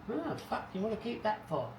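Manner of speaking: high-pitched imitation of a scoffing wife; mocking wife. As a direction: put on a high voice to mimic your wife's disbelief. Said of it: "My wife often laughs at me and says".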